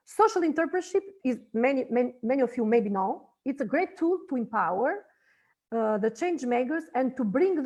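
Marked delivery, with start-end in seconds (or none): none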